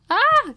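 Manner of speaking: short, high, loud squeal of cringe; embarrassed squeal. Real, not acted. Vlogger cringing at complimenting herself, high embarrassed squeal.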